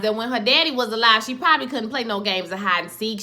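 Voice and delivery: ordinary talk, nothing marked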